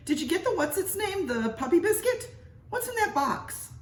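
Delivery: high, shrill-pitched scolding wife voice; nagging wife. Acted. Missus Mitty scolding her husband in the hotel, high nagging. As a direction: scold him like an impatient wife.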